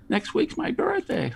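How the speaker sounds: put-on high, slow little-kid voice, asking sweetly. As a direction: mimic a young boy dropping a hint. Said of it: "he said".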